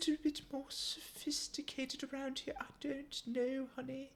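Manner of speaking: soft, hushed posh put-on; a hushed mock-refined tone; sophisticated voice. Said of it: "Why am I still talking like this?".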